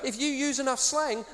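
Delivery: ordinary talk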